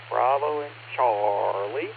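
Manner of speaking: "Bravo and Charlie" slowly drawn out, rising at the end; slow repeat. Real, not acted. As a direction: repeat the answer slowly so it's noted. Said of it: "I'm gonna go with Bravo and Charlie".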